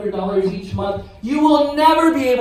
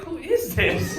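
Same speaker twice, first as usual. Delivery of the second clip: "Who is this?" said softer, with no surprise; deadpan, indifferent "Who is this?"; a flat, unmoved wife looking at the screen; unimpressed wife; 4 semitones higher, 5 dB quieter, slower than usual. Retold or imitated. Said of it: "No, she was just like".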